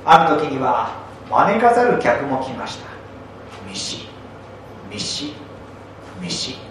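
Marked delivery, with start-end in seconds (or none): none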